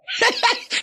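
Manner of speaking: evil chuckle. Acted. Villain laughs before asking Lugo.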